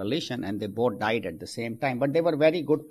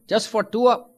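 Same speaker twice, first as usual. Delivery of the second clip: amazed question; 9 semitones higher, 5 dB louder than usual. Acted. Amazed newcomer asks St. Peter.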